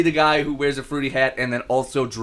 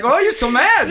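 high, laughing attempt at an Indian accent; failed comic Indian accent; badly faked Indian accent; 12 semitones higher, 3 dB louder than usual. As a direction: fumble a mock Indian accent. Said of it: "I can't even fucking do that one".

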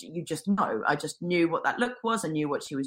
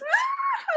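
sudden high-pitched crying wail; mock bawling wail. Woman breaking down before the healer, mock bawling. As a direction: burst out in a hammed-up sob. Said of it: "booed my eyes out".

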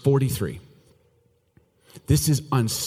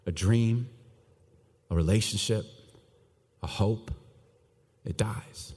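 unvoiced and much softer; whispered; 3 semitones lower, 6 dB quieter than usual. Preacher naming dreams that die, hushed. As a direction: let it fall away to a whisper.

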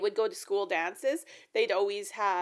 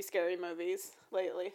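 normal talking voice

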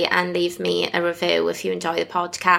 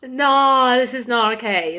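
tearful protest; 2 semitones higher than usual. Real, not acted. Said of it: "I am actually mortified that I cried".

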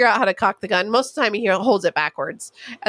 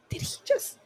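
stunned, whispered half-question; shocked whisper; 12 semitones higher, 11 dB quieter than usual. Retold or imitated. Aunt to the mom after the toddler swears, shocked whisper. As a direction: breathe it out in disbelief. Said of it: "you're like".

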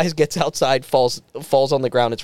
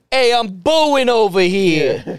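slow, higher-pitched Italian-American wiseguy holler; Italian mobster ghost; 5 semitones higher, 3 dB louder, much slower than usual. Acted. Comic pitching a title screen, Italian mobster ghost. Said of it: "Three Italian ghosts".

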